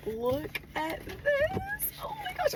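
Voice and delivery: high, soft, crying voice; real tearful awe. Real, not acted. Showing her award to viewers, tearful and high. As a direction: look at it through the tears. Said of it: "let me not get my tears on it".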